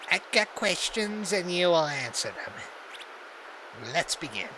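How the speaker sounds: a shadow promising an interrogation; slow menacing hunter voice. Acted. Hunter threatens captured guard.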